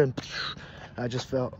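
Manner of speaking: ordinary talk, nothing marked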